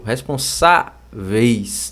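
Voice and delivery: slow "Responsáveis", pause between SA and VEIS; syllable split. Shown as a demonstration. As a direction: break "Responsáveis" after the stressed SA. Mostrando a divisão silábica. (separando as sílabas devagar)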